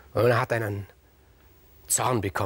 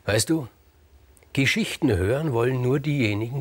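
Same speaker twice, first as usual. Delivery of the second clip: usual speaking voice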